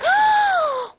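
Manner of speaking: high sharp gasp of horror; loud shocked gasp. Retold or imitated. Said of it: "I was just like".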